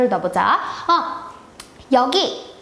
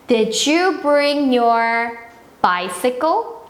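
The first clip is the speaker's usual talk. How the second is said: very slow, clear teacher demo; slow model sentence; much slower than usual. Shown as a demonstration. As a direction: stretch each word out for the kids. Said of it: "너는 내 자전거를 가져왔니". (아주 천천히 또박또박)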